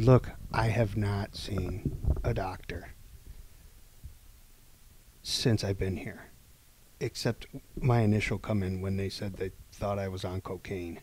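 lightly voiced weary patient, quiet and slow; quiet, slow plea. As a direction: hush it down, tired of waiting. Retelling a plea to the nurses.

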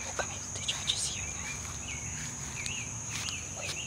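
tense hushed whisper. Real, not acted. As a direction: whisper so nobody hears. Hiding, listening for a noise.

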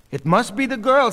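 high, loud gossip; excited speculation. A narrator's reading. Pearl Katz up on her toes, excited guess. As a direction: call out a guess over the crowd.

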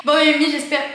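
too-loud opening greeting; big greeting that rings back; loud intro. Shown as a demonstration. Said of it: "Je vais pas parler comme ça". (intro trop fort)

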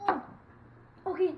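ordinary talk, nothing marked

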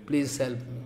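hushed humble plea; soft prayer. Real, not acted. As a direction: pray quietly for help. Prayer to the Gopikas for help.

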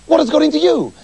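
the doctors crying out high and loud at their thieving friend; exclaimed. Acted. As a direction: cry out in dismay. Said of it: "they exclaimed".

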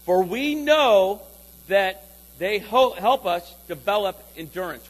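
slow, deliberate read-aloud; slow group reading. Shown as a demonstration. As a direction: lead the room reading it together. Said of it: "Read this out loud with me".

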